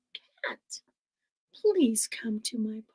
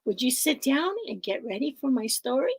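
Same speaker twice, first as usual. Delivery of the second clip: ordinary delivery, nothing marked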